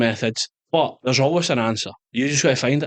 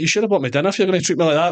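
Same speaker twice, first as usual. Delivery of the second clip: quick, higher, cheeky retort; mock indignation; 6 semitones higher, 4 dB louder, faster than usual. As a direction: fire it off fast as a cheeky joke. Joking retort to officers.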